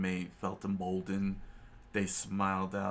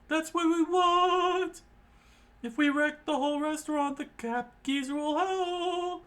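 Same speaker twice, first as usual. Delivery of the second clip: high yelling; 5 dB louder than usual. A narrator's reading. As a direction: yell it high, stretching the last words. Cook yells back in glee.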